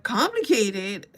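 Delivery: slow, drawn-out incredulous echo; mock-baffled, drawn-out question. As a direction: stretch it out in disbelief. Incredulously echoes his answer.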